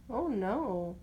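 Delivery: usual speaking voice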